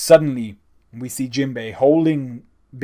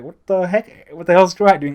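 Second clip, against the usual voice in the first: higher, louder imitation of an outraged villain; mock baffled yell; 6 semitones higher, 7 dB louder than usual. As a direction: play Queen's disbelief, hammed-up. Queen baffled at ignoring Luffy.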